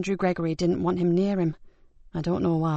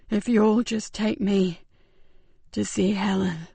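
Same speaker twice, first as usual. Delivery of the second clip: weak dying man; slower than usual. Acted. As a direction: barely hold on to each word.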